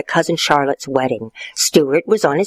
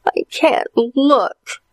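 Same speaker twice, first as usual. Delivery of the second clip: whimpering; 9 semitones higher, much slower than usual. Acted. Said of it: "whimpered his sister Allison".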